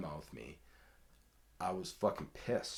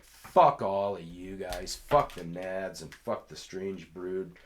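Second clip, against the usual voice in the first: loud, slow, spat-out curses; angry message; 8 dB louder, slower than usual. Retold or imitated. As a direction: lay into them word by word. Reciting his angry messages.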